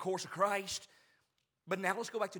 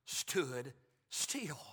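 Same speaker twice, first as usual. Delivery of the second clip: sudden slow refrain; much slower than usual. Real, not acted. Preacher halting the rush toward Jerusalem, slow and deliberate. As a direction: drop back and space out "stood still".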